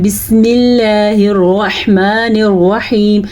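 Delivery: slow recited bismillah with held vowels; Quranic recitation. Shown as a demonstration. Teacher reciting an example for students, measured tajweed recitation. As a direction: recite it steadily in tajweed style. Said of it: "جیسے ہم پڑھتے ہیں".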